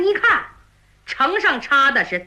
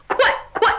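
higher, hurried urging; urgent hurry; 8 semitones higher than usual. Acted. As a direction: spur the horse on in a panic. 牛皋逃跑催马. (牛皋慌忙催马快跑)

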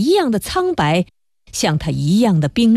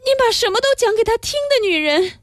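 faint woman's voice; 13 semitones higher than usual. Acted. Dying woman's delirium.

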